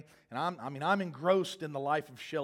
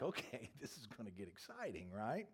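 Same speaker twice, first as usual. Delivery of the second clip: amused laugh; 4 semitones lower, 12 dB quieter than usual. Retold or imitated. Watching the fight unfold, amused.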